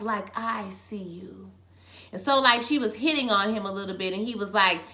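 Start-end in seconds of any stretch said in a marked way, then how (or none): 0.0-1.5 slow, soft, drawn-out delivery; hushed, deliberate mock intimacy; imitated close-up intensity. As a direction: say it slowly, right up close. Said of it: "gets right in his face".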